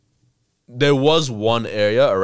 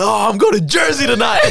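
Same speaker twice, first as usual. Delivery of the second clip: higher, louder imitated happy announcement; excited shout; 12 semitones higher, 5 dB louder than usual. Stranger with four dollars tells the tourist his plans, excited shout. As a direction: blurt it out, thrilled.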